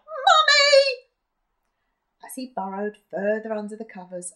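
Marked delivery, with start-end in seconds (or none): 0.1-1.1 high, loud frightened wail for mummy; desperate shout. Acted. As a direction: scream for mummy in terror. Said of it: "he desperately called out".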